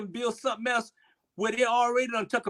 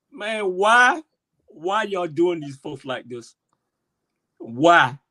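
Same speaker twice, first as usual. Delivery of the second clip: upset plea with loud bursts on why; emotional; 4 dB louder, slower than usual. Real, not acted. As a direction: plead it, hitting each why hard. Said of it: "I'm getting emotional".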